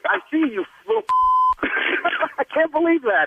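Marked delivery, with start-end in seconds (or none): 1.1-2.3 a real, very high squeal of a laugh; high burst of laughter. Real, not acted. Pranked clerk laughing hard after the reveal, high squealing laugh. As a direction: break into a helpless high laugh.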